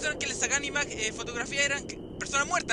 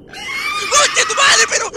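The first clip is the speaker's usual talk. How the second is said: slow, loud, much higher panicked shout; terrified scream; 15 dB louder, much slower than usual. Real, not acted. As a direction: scream it in pure fright. Grito de susto. (grito aterrado)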